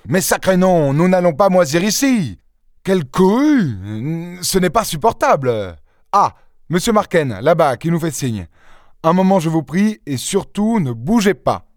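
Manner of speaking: agacé, beaucoup plus aigu; râle contre la cohue; furieux. Acted. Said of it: "d'un air furieux". (inspecteur excédé par la foule, ton furieux et aigu)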